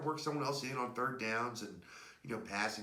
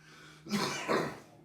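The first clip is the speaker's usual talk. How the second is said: dry hacking cough; coughing fit; 4 dB louder than usual. Real, not acted. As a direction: cough hard, catching your breath. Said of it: "sorry, I'm".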